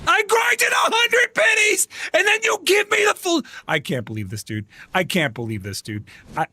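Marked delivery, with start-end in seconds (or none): 0.1-3.4 angry shout. Real, not acted. Streamer ranting at the game about wasted grinding, yelling. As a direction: vent the fury at the game.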